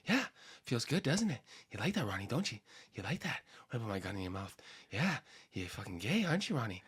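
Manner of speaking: soft, low, breathy bro voice; Mark Wahlberg. Acted. Comic taunting his cohost in character, soft and low. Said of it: "Mark Wahlberg? You just gotta be out of breath".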